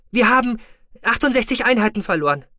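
high, loud, urgent report; female officer's voice. A narrator's reading. Female tracking officer reports battle losses, high and urgent.

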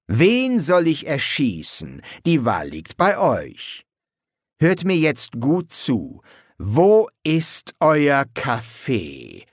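slow threat building to loud demand; menacing demand. A narrator's reading. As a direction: draw the words out, then press harder. Demands coffee at crossbow point.

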